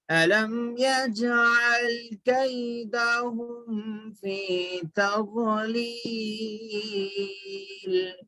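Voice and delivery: slow, measured tajweed chanting; Quran recitation. Shown as a demonstration. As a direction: draw out the long vowels, fading on the last. Recites verse for learners.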